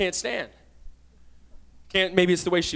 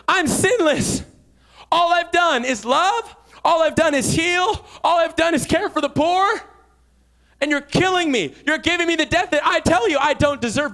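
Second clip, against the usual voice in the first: pleading Jesus; 7 semitones higher, 4 dB louder than usual. Retold or imitated. Jesus voiced pleading his innocence.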